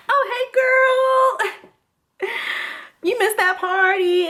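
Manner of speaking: high, drawn-out phony friendliness; put-on sugary nonchalance; fake-casual greeting. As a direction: overdo the innocent hello, stretching it out. Feigns casual greeting to angry friend.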